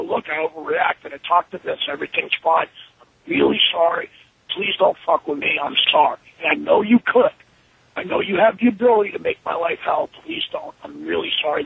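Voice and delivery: low-pitched, subdued pleading; contrite apology. Retold or imitated. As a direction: apologize humbly, as retold. Retold apology to John.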